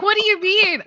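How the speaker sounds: high, loud incredulous question; retold bafflement. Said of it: "She's like".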